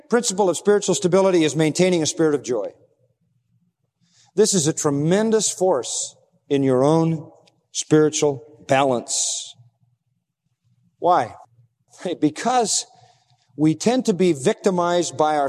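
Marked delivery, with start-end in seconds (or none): none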